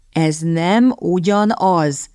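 "Ez nem ugyanaz" drawn out slowly, every word weighted; slow, insistent stress on each word of "Ez nem ugyanaz"; emphatic. A narrator's reading. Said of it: "nyomatékosította Redcliffe".

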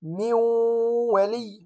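من ولي with the nun merged into waw and held nasal; nasalized merge. Shown as a demonstration. Teacher reciting the correct merged nun. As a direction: recite من ولي with two-beat ghunna. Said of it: "ولا أقول".

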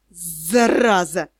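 low, slow hiss with stretched consonants; hissed curse. A narrator's reading. Magister cursing as he tries to straighten, hissing. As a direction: hiss it through the pain. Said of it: "прошипел Элохор".

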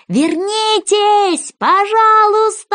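long, high, loud drawn-out call; slow high shout of please come back; shouting together. A narrator's reading. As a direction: call after her as she walks away. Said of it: "все остальные тоже закричали".

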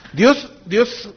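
ordinary delivery

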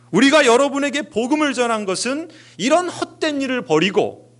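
high-pitched, urgent appeal to a crowd; retold crying out. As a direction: call out as the apostles pleading, without a full shout. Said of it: "소리를 지르면서 외칩니다". (군중에게 높은 음으로 외치듯 호소)

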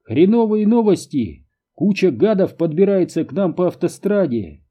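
high-pitched, agitated radio report; urgent bad news. A narrator's reading. Pilot urgently reports approaching enemy. (взволнованное срочное донесение)

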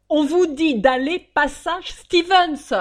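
loud, slow insisting order; impatient shout. Acted. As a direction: hammer out the address again. Passenger insists on the address.

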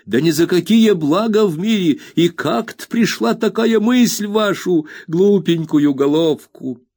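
high, slow scandalised nursemaid; flustered old nurse. A narrator's reading. Peggotty voiced high.

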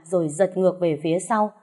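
ordinary delivery

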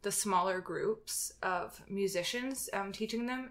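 ordinary talk

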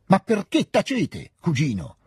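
high, drawn-out indignant outburst; sudden cry. A narrator's reading. Princess to her silent cousin, sudden high cry. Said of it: "gridò a un tratto la principessina".

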